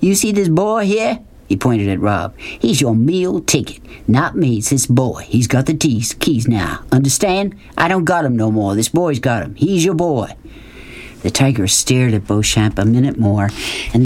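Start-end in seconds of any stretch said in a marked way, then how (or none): 0.0-1.2 calling out. Acted. Man presenting a boy to his tiger, calling out in character. 2.4-10.3 insistent Southern schemer. Acted. Narrator voices Beauchamp.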